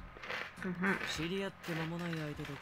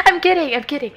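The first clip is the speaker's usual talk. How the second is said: laughing; 6 semitones higher, 6 dB louder, faster than usual. Real, not acted. Laughing take-back.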